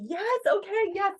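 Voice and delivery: high, loud burst of yeses; elated agreement. Retold or imitated. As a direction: re-enact an ecstatic breakthrough. Acts out her elated agreement.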